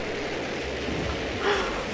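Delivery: airy wheezing laugh; breathless high laugh. Real, not acted. Gamer wheezing with laughter at the goat chaos. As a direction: laugh till no voice is left.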